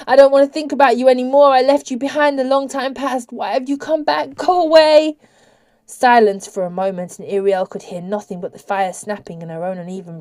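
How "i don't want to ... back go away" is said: high, hurried, frightened pleading; raised, agitated, haunted voice; frantic. A narrator's reading. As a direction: plead in a panic to be left alone. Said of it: "grew frantic".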